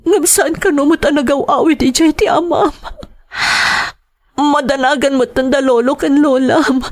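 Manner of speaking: tearful worry. Acted. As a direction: let the fear of losing him rise. Mother breaking down over missing husband.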